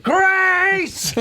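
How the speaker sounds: high yelled call from afar; shouting a name. Retold or imitated. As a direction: bellow the name across the room. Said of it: "Rooney being like".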